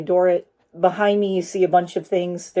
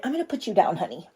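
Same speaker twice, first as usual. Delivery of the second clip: talking to pet; 7 dB quieter, faster than usual. Real, not acted. Setting down her pet mid-video.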